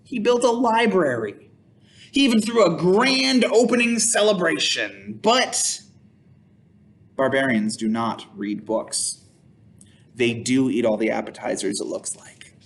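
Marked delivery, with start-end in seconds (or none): none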